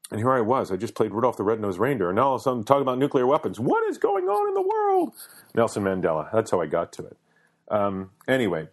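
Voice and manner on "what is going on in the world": high-pitched cry of disbelief; exasperated exclamation. Real, not acted. Host amazed he went from Rudolph to nukes, high exasperated cry. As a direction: throw the question up to the sky, pitch soaring.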